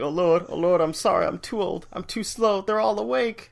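high wailing lament; lamenting old woman. Retold or imitated. Old woman failing to hold the dead back, high and wailing. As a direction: cry out in despair, pitched high.

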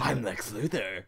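low, slow villain voice; Lex Luthor. Acted. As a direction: announce yourself as a villain, low and soft. Plays gloating villain Lex Luthor.

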